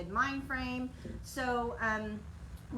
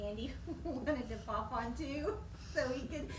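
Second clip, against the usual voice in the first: real giggle breaking into the words; trying to talk through giggles; giggling; 3 semitones higher, 4 dB quieter than usual. Real, not acted. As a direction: fight the giggles while talking. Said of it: "I've been giggling over here".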